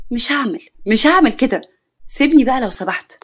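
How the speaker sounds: defiant refusal. Acted. Acted defiant refusal in quarrel.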